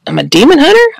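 high, rising incredulous question; can't believe your new job title. Retold or imitated. Mock disbelief at own new label.

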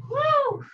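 high joyful woo; excited whoop. Real, not acted. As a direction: cheer it out high. Instructor cheering mid-workout.

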